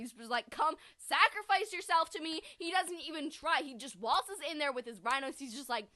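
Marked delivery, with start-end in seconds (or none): 0.5-2.4 lightly acted villain, higher and louder; hammed-up summons; mock villain command. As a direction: demand surrender in a raised voice. Voicing Zuko's demand to Aang.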